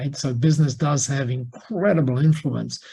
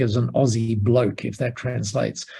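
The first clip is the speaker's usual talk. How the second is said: normal talking voice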